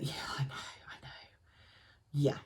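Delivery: quick whisper. Real, not acted. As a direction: rush it under your breath. Aside to shocked viewers.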